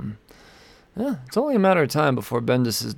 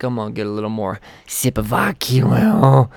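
ordinary talk

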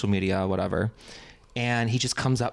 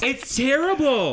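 high, slow laughing cry; laughing shout; 14 semitones higher, 4 dB louder, slower than usual. Real, not acted.

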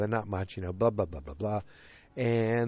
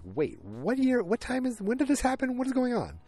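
much higher, baffled squeak of disbelief; stunned disbelief; 14 semitones higher than usual. Retold or imitated. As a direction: ask it like you can't believe your eyes. Own shocked reaction retold.

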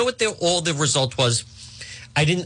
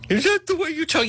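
higher mock listener; 14 semitones higher, faster than usual. Mocking a listener's complaint again.

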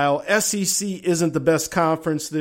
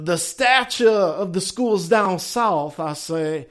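a high, put-on Southern accent in the style of Foghorn Leghorn; a hint of a Southern cartoon rooster accent; hammed-up Southern drawl; 6 semitones higher, slower than usual. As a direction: play up a folksy Southern accent.

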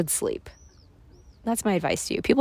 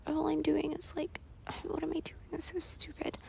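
self-mocking whisper; 9 semitones higher, 9 dB quieter than usual. Inner critic voice, whispered.

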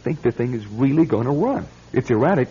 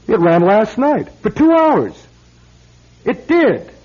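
insistent; 10 semitones higher, 8 dB louder than usual. Acted. Insisting the clock ran.

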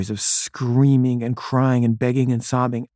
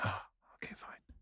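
barely audible, giving in; resigned whisper; 25 dB quieter than usual. Retold or imitated. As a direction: whisper a defeated, reluctant agreement. Gives in to wife, retold.

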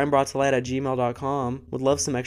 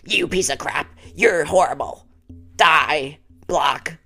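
mock angry reply; 3 semitones higher, 5 dB louder than usual. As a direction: fake fury at a commenter. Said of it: "reply to your comment and be like".

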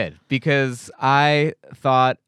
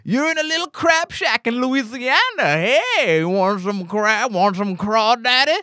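put-on Louisiana accent; 9 semitones higher, slower than usual. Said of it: "they make it out like".

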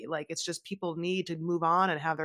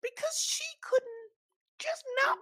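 mock high voice. Host spoofing her prison complaint, in a very high put-on voice. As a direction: pitch it way up and stretch it out theatrically.

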